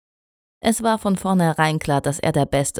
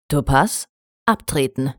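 lower, firm drill-ground order; drill command; 3 semitones lower than usual. A narrator's reading. Shining Armor dismisses a pony from the drill, firm command. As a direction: order a recruit off the field. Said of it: "rief Shining Armor".

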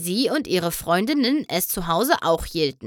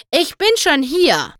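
higher, louder announcement; calling out; 7 semitones higher, 5 dB louder than usual. A narrator's reading. Twilight steps out of the shadows to the ponies, calling out loudly. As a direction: burst in with it.

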